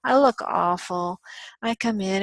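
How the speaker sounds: normal talking voice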